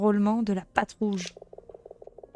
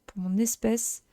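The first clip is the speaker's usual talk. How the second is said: normal talking voice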